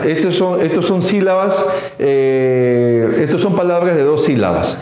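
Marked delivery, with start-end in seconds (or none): none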